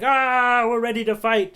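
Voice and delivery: high, loud hammed-up pirate cheer; a hungry pirate ready for a brawl, cheering high and loud; mock pirate cheer. Pirates full after eating, a hammed-up cheer.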